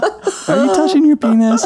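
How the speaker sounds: very high little-kid imitation; Adam. Dad re-enacting his little son's question, very high child voice. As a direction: ask it as a small boy, voice way up. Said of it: "he goes".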